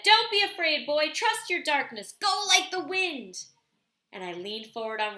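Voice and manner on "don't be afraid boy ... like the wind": high, loud encouraging call. Retold or imitated. Grandfather calling to the frightened boy at the start, loud. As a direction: urge the boy on from afar. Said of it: "I said".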